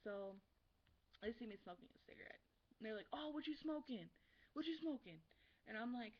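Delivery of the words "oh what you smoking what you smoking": high, loud, nosy mock questioning; imitated pestering kids. Kids pestering her about a cigarette, mock nosiness. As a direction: mimic kids butting in eagerly.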